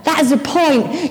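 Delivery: ordinary talk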